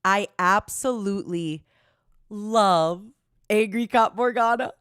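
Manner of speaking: every word hammered out; emphatic repeat. Acted. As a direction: say it again like you really mean it. Repeats the line with more punch.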